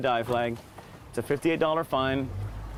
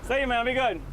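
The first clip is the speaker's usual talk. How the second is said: calling out; 13 semitones higher, 3 dB quieter than usual. Real, not acted. Calling across to boaters.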